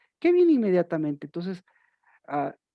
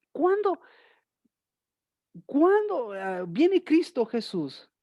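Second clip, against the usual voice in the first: rhetorical cry; 6 semitones higher, slower than usual. Acted. Rhetorical question to congregation.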